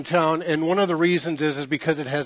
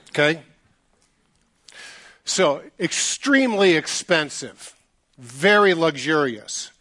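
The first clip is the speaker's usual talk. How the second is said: normal talking voice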